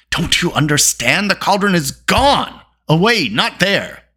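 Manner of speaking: high, exasperated outburst, slowing for emphasis; about to explode. A narrator's reading. Dolly telling them the cauldron is gone, exploding. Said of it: "as if he were about to explode".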